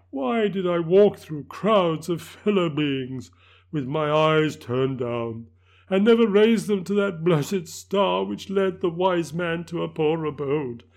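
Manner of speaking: high, loud anguished spirit; a damned soul's slow lament; unearthly voice. Acted. Ghost laments.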